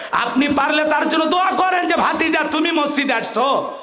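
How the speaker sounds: high, ringing sermon delivery; impassioned preaching. Real, not acted. Preacher promises the crowd the Prophet's cup, impassioned preaching. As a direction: preach it at full force to the crowd.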